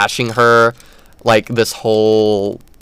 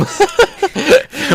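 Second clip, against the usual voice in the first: high giggle. Real, not acted. Laughing at Cotton's death.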